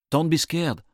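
loud reassurance. Acted. Narrator to Reese in the rift, loud reassurance. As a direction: call down to someone frightened.

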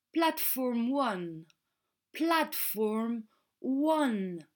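very slow, drawn-out "Platform one"; language-lesson slow "Platform one"; slow model pronunciation. Shown as a demonstration. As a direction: say "Platform one" word by word, stretched out. Words repeated slowly for learners.

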